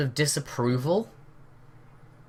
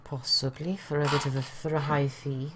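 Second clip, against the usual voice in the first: put-on Welsh accent; 5 dB quieter than usual. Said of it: "really bad Welsh accent".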